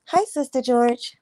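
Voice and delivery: ordinary talk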